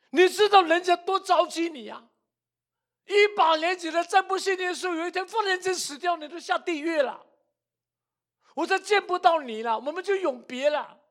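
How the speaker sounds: imitated crying daughter, very high-pitched and distraught; tearful, urgent plea. Daughter begging her mother to believe, acted tearful plea. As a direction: wail the complaint at mum in a high, pleading voice. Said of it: "这个女儿就哭出来了".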